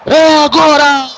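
screaming goal call, stretched and high; explosive goal commentary. Real, not acted. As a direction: roar the goal, drawing out every word.

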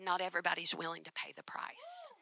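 low hushed warning; whispered. Retold or imitated. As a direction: whisper it like a secret truth. Manager's hushed lesson.

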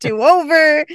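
high, loud, half-laughing plea; mock panic. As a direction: playfully blurt a panicked retraction. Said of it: "Waverly's panicking and she's like".

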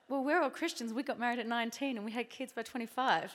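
mock the self-righteous congregation; loud, high parody of church smugness; smug married folk. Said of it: "you're all like".

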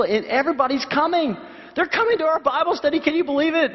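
retelling a friend's news, pitch high and bright with joy; excited. Retold or imitated. Church lady tells her pastor her excited news. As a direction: gush it like she can't believe it. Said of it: "She was so excited".

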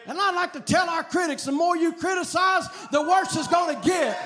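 building to a high, shouted climax; defiant revival preacher; full-force preaching. Real, not acted.

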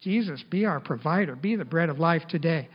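soft, fast pleading prayer; mild retold prayer, soft and quick; retold tearful prayer. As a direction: pray it softly, a touch of tears. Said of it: "we're crying and praying".

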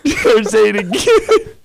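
high, slow plea through laughter; laughing almost to tears; real helpless laughter. Real, not acted. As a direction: beg while cracking up. Whole group in hysterics.

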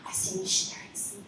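put-on a small child's high whisper; awed granddaughter. A granddaughter whispering to grandma about missionaries. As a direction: breathe it like a little girl seeing a vision. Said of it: "started saying".

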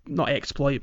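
usual speaking voice

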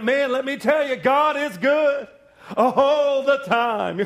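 high, loud, drawn-out shout of praise; joyful praise. Retold or imitated. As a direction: stretch out God is good in triumph. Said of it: "you're like".

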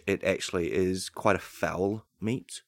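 ordinary talk

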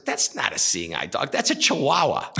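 deadpan start, then a jump up high on the dog's breed; spring the punchline upward; bartender. A narrator's reading. Bartender points out the dog's breed with a sharp high rise. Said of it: "bartender says".

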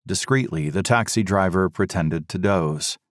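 ordinary delivery, nothing marked

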